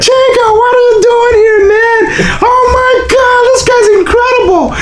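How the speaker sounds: very high, shouted teasing voice; mocking bandmates. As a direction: rib him like laughing friends. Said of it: "It's Chico!".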